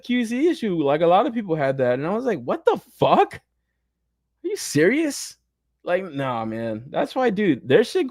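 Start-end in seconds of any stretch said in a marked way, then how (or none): 2.4-5.4 high, drawn-out, stunned disbelief. Retold or imitated. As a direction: stretch it out like you can't believe it. Retold reaction to broken canopy.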